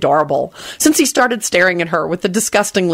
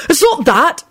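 blurting; 10 semitones higher, 3 dB louder than usual. A narrator's reading. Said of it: "Neville blurted out".

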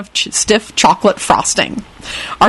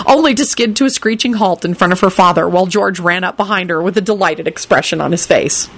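ordinary delivery

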